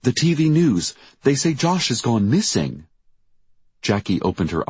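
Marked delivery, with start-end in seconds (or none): 0.0-2.9 high, anxious telling; distressed. A narrator's reading. Diane telling Jackie her son is missing, distressed. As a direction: report it upset, pitch lifted. Crying mother tells Jackie the news.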